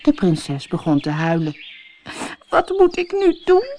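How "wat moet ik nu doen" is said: sobbing princess. A narrator's reading. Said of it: "snikte ze".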